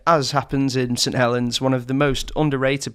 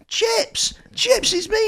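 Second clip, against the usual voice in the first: very high-pitched imitation of hailing someone; retold calling out; slower than usual. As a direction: call for recognition in a high mock voice. Retold friend hailing unresponsive lad.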